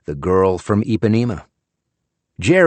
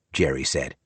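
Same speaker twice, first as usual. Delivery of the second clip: ordinary delivery, nothing marked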